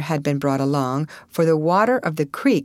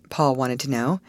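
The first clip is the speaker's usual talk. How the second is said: ordinary delivery, nothing marked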